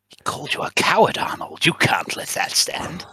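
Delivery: conspiratorial whisper; whispered egging on. Acted. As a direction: lean in and hiss it. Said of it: "lean into Arnold and go".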